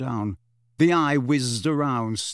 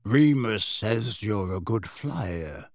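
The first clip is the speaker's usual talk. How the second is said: very slow, deep voice; 3 semitones lower, much slower than usual. A narrator's reading. Kingsley praising Harry's flying, deep voice. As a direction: let it roll out deep and unhurried. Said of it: "in his deep voice".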